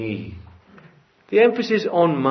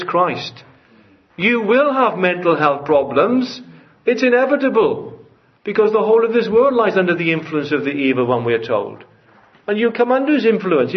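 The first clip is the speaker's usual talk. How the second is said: raised, louder, urgent pulpit warning; fervent preaching; 6 semitones higher, 4 dB louder than usual. Acted. Preacher warning young people, fervent and raised.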